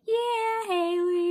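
high sing-song reply. Retold or imitated. Replying to classmate, high, far.

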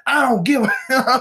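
high-pitched mock outburst, drawn out; blunt, defiant rant. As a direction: imitate a host who pulls no punches. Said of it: "He does not pull punches".

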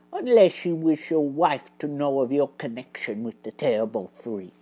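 quick, low, soft warning. A narrator's reading. Basil threatens to expose Hawkins to his wife, in a low voice. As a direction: keep it down, low and brisk. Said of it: "in a low voice".

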